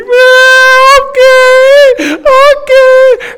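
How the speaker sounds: put-on very high, loud sobbing toddler voice; toddler crying. Father voicing his crying daughter after the scolding, high loud sobs. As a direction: wail it like a scolded little girl. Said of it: "she's like".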